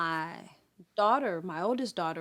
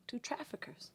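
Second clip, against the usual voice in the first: ordinary talk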